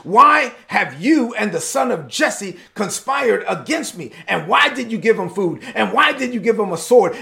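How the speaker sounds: shouting king. Acted. King accusing the priest of conspiring against him, acted shouting. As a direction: hurl question after question like an enraged king. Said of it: "he starts shouting right there at Ahimelech".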